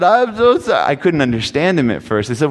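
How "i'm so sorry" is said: mild imitated crying boy. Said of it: "bawling his eyes out".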